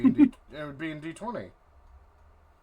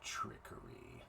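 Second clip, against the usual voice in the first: whispered scorn; 15 dB quieter, slower than usual. Acted. Deity sneers at god's name.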